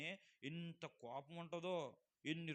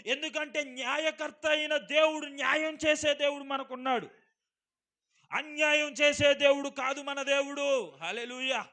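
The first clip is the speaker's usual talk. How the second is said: full force preaching; 8 semitones higher, 16 dB louder than usual. Acted. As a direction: thunder the verse at the congregation. (గట్టిగా నెమ్మదిగా ప్రసంగం)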